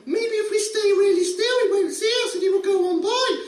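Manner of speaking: hammed-up frantic whisper-plan shouted; a mum half-screaming a hopeless plan; mock screaming mum. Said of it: "Mum screamed again".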